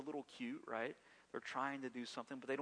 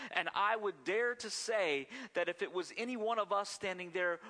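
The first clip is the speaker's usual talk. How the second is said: preacher rising to full force; building sermon; 3 semitones higher, 4 dB louder than usual. Real, not acted. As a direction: drive the point home to the congregation.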